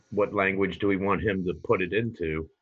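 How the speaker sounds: ordinary delivery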